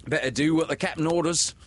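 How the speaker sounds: high, quick nervous pirate. A narrator's reading.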